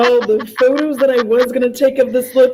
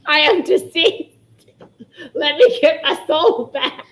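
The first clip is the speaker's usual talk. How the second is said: ordinary talk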